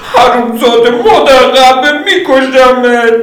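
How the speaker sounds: high enraged female yell; Lydia screaming. Acted. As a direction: scream the curses at him. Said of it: "لیدیا جیغ کشید".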